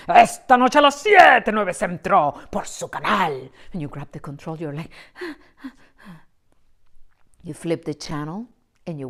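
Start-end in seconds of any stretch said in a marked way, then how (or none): 0.1-3.5 loud booming promo voice; TV announcer. Retold or imitated. Spanish channel commercial announcing tonight's show, loud. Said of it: "And then you see the commercials".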